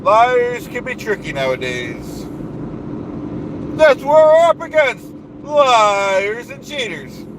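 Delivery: blustering self-important boss voice; pompous ranting persona. Acted. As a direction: puff up like a bragging strongman. Holds the Duke persona rant.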